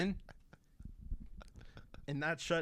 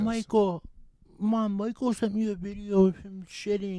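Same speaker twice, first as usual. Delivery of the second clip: higher-pitched mock tattletale; tattling sibling; 4 semitones higher than usual. Sibling reporting the video to mom, mock tattling. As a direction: imitate a sibling telling on someone.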